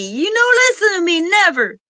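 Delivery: exasperated complaint. Real, not acted. Annoyed at being ignored.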